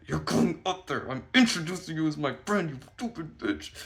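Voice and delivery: high, loud exasperation; acted angry order. Ordering friend to come be introduced, acted anger. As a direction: order them in mock fury.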